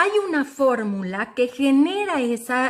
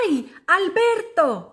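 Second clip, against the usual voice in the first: friendly call; 5 semitones higher, slower than usual. Acted. Friend peers into the hole.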